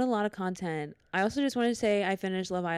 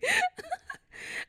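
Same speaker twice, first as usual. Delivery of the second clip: high, breathy cackle of laughter; squealing giggle with a near-crying edge. Real, not acted. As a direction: crack up helplessly at your own story. Cracking up at own story.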